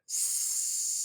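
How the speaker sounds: long voiceless hiss on "s"; pure S sound. Shown as a demonstration. Teacher testing the student's ear, long hissed S. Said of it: "so I'm saying".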